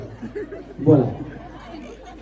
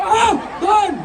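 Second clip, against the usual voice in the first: cheering count; 8 semitones higher, much slower than usual. Real, not acted. Host cheers and counts take.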